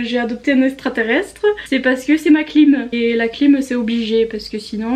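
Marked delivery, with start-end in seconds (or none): none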